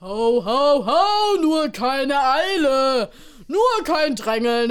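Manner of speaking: loud, slow ho ho ho as Santa; jolly Santa. Acted. Santa character voice.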